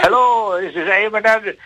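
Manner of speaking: imitating an Irish greeting on the phone; Eamon Andrews Irish voice. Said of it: "an Irish voice".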